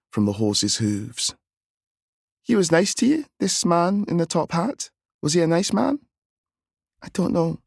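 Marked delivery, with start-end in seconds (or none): 2.4-6.0 higher, gentle questioning woman; aunt's voice. A narrator's reading. Aunt questions the boy. 7.0-7.6 put-on higher, unsure child; little boy voice. A narrator's reading. Boy answers.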